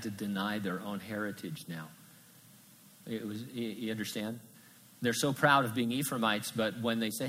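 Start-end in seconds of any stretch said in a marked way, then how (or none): none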